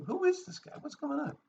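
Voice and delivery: high-pitched retold exasperation; a little pissed off. Teller recalling his growing impatience with his friend, a little pissed off. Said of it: "starting to get a little pissed off".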